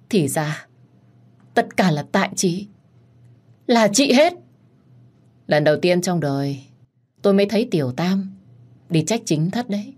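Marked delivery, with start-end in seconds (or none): 0.1-4.3 mild growl of blame. Acted. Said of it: "nó gầm gừ".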